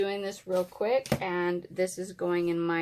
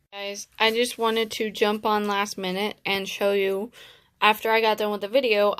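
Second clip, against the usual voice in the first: usual speaking voice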